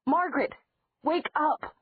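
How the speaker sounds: high, loud call through glass. Acted. Elena yells through the window to wake Margaret, high shout. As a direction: yell to wake the sleeping child.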